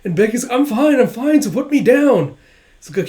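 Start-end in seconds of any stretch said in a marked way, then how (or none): 0.5-2.4 higher and louder, insistent; protesting. Retold or imitated. Becky carried off by Yor, loud protest. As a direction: squirm and insist.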